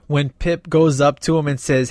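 usual speaking voice

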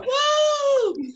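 cracked high woo; voice cracking. Real, not acted. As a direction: voice breaks on a cheer. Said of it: "My voice just cracked there".

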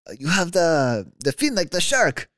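high, loud mock Italian accent; Italian medic. Medic telling the rider about his fin, mock Italian accent. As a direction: play the medic, Italian lilt, amused. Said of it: "what accent was that?".

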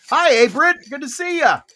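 loud, high-pitched welcome; excited greeting. Real, not acted. Host welcomes Abrit arriving at the show, excited greeting. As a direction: greet them with real excitement.

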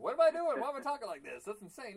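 high, loud self-parody of a host; mock dramatic announcer. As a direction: overdo the dramatic voice, mocking yourself. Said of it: "I don't know why I went so dramatic".

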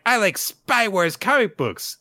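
put-on high, loud, eager kid voice; excited child. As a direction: blurt it out like a keen little kid. Retold child excitedly naming favourite books.

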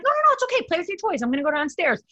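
high, earnest mock mothering; earnest, sweetly insistent girlfriend; dead serious. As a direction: insist kindly he keep playing. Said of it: "I was dead serious".

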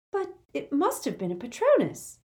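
lightly voiced clever girl; a hint of an insistent schoolgirl; young girl voice. A narrator's reading. Hermione voiced by narrator.

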